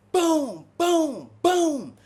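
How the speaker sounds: loud imitated kicks on the door. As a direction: slam each boom like a kick on a cell door. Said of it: "Kicking the door down".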